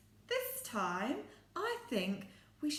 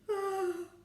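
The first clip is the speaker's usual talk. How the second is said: gasp; 8 semitones higher, 5 dB louder than usual. Acted. Surprise at clue.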